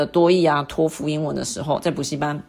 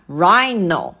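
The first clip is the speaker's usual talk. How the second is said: rhino drawn out, RHI raised; teacher's demo of rhino, stressed up front; stress on first syllable; 3 dB louder than usual. Shown as a demonstration. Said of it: "当然是第一音节嘛".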